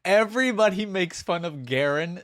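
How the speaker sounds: can't contain how much you love it; delighted. Real, not acted. Praising the Garen jab to viewers, high gushing excitement.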